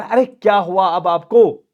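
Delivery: loud, high, slow exclaimed question; alarmed father. Acted. Father asking fainting daughter what happened, alarmed.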